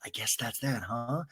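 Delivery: low, soft aside; Charlie's whisper. Retold or imitated. Recapper imitating Charlie whispering as the others watch, hushed. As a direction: murmur it like an awkward onlooker. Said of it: "Charlie whispers".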